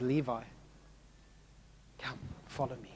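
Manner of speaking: Jesus quietly invites Levi to follow; gentle call. Retold or imitated. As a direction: invite him gently and personally. Jesus calls Matthew personally.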